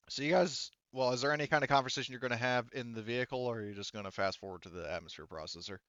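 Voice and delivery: usual speaking voice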